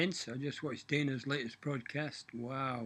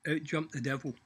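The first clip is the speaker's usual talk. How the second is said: normal talking voice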